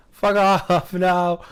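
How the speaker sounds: panicked shout at a monster; yelling while running away. Real, not acted. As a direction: yell it while fleeing in terror. Fleeing monster in horror game.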